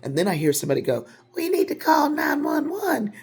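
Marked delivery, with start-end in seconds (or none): 1.3-3.1 high, loud, half-laughing panic; panicky bystander. Retold or imitated. A bystander urging others to call for help, high panicky shout. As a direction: play the frantic bystander, laughing.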